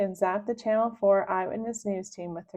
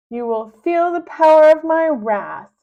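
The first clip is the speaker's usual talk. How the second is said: high, loud menacing villain voice; a kid villain announcing doom; villain threat; 7 semitones higher, 10 dB louder than usual. Acted. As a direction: threaten like a cartoon tyrant.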